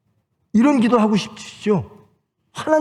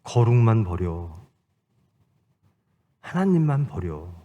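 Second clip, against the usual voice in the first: lightly voiced tempter, softening on the final offer; Satan; 8 semitones lower, 5 dB quieter than usual. Satan tempts Jesus to give up holiness, quiet coaxing. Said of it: "사탄이 그렇게 유혹합니다".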